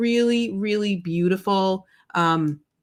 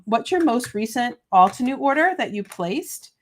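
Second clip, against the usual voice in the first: ordinary delivery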